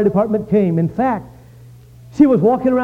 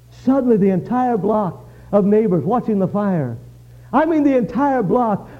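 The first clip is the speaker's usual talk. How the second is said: ordinary talk, nothing marked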